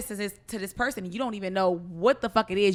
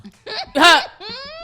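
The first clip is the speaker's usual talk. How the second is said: high burst of laughter; loud laugh; 11 semitones higher, 8 dB louder than usual. Real, not acted. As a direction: crack up loudly.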